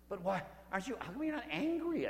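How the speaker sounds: can't believe he isn't angry; incredulous monk. Retold or imitated. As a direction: pitch leaps up in disbelief. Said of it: "I said".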